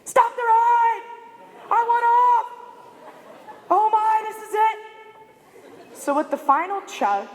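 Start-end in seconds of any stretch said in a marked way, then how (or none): 0.0-5.9 much higher, louder, slow panicked shriek; retold panicked screaming. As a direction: scream it like you're stuck on the ride, terrified. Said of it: "I am just screaming".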